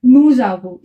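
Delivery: नू जाबो said noo-zabo, the s of nous sounded as z before avons; French liaison demo. Shown as a demonstration. Teacher shows students the nous avons liaison, a careful z link. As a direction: run नू जाबो together with a z sound linking nou and abo. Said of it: "इसे ना हमें नू जाबो pronounce करेंगे".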